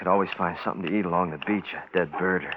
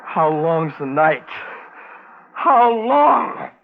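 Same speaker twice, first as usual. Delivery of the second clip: higher and louder, slow despairing cry; anguished cry; 9 semitones higher, 8 dB louder, much slower than usual. Acted. Desperate man waiting for dawn.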